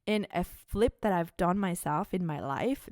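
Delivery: ordinary talk, nothing marked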